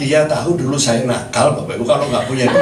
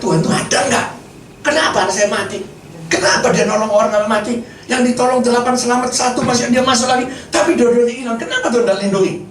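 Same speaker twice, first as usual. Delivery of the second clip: retold anguished protest, pitched higher; angry at God; 6 semitones higher than usual. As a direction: challenge God in a higher, insistent voice. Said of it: "Marah sama Tuhan saya".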